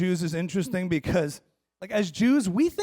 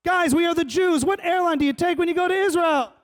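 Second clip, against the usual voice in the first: high, raised-voice scolding question; exasperated demand; 11 semitones higher, 4 dB louder than usual. Real, not acted. Comedian scolding audience for wrong airlines, exasperated. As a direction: push it up high in mock outrage.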